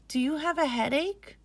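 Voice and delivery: put-on high boy's voice, rising question; worried young boy. Boy asking his sister about her headache. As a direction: ask like a caring little brother. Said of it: "Majid asks".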